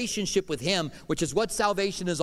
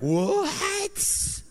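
a sudden squeak of disbelief, pitched way up; shocked what. Retold or imitated. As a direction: throw it up high like you can't believe your ears. Coworker shocked at refusing beer.

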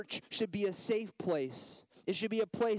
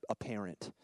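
normal talking voice

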